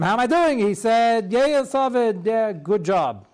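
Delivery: mock eager killer and rabbi. Killer asks rabbi, rabbi answers.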